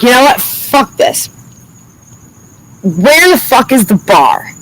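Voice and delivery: exasperated, slowing into the demand; retold fed-up outburst. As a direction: give up in a huff, dragging out the final question. Retells storming off to the bar.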